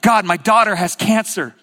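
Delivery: loud, anguished prayer; crying out. Acted. Preacher voices a parent praying for a sick daughter, crying out. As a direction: hit it hard as a desperate plea. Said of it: "I'm praying and crying out".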